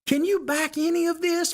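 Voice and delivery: a high, worked-up rhetorical jab. Real, not acted. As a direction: voice jumps high in disbelief. Challenging Democrat voters on air.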